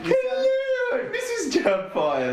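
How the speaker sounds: very high falsetto hello; high hammed-up matronly film-character voice; Missus Doubtfire. Host's failed Irish turns into Missus Doubtfire falsetto. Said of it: "That's what it sounds like".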